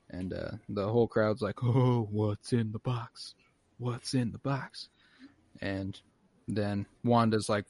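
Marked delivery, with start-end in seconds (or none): 1.6-4.9 slow, drawn-out crowd chorus; awed crowd. Retold or imitated. Whole crowd watching the magic box, slow mock awe. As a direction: imitate an audience wondering in unison, stretching the words.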